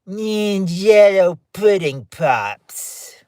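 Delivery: drawn-out mock ad voice with a hummed "Mm"; Bill Cosby impression. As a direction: savor each word like a dessert commercial. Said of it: "My regular Bill Cosby impression".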